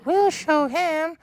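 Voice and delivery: very high, slow squeaky taunt; mischievous trucks. Acted. Naughty trucks plotting against Toby, high squeaky taunt. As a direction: squeak it slowly like little troublemakers. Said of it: "said the cars".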